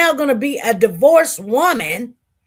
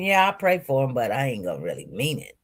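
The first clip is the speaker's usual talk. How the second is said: softer, fast private aside, barely voiced; inner thought; 11 semitones lower, 8 dB quieter, faster than usual. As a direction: say it like a thought you hide. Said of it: "I'm thinking".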